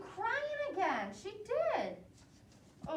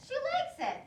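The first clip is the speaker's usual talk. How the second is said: high, fast and proud; pleased Daniel; 12 semitones higher, faster than usual. Acted. Brother proudly showing mom the baby calmed, high and fast. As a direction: rattle it off, a delighted little boy. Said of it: "And Daniel says".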